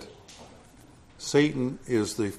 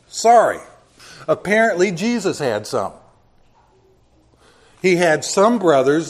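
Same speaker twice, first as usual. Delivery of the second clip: louder, insistent pulpit preaching; 6 semitones higher, 8 dB louder than usual. Acted. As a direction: drive the point home from the pulpit. Preaching at full force.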